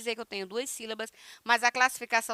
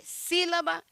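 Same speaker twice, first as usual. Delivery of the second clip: "sílaba" with the first syllable "sí" pitched high; stress on SI; 6 semitones higher than usual. Shown as a demonstration. Teacher showing why it takes a tilde, stressing SÍ in "sílaba". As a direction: lift and lean on "sí" at the start of "sílaba". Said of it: "si é mais forte".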